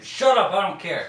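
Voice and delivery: loud, high defiant outburst; defiant shout. Real, not acted. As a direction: shout down the critics. Defiant outburst about the film.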